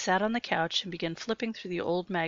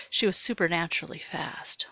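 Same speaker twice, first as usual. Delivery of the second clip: plain reading voice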